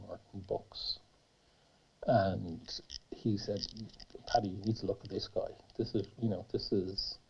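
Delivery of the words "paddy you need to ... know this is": quiet, quick, confiding aside; hushed confiding quote. Retold or imitated. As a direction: drop the voice and share a secret. Friend in pub confides.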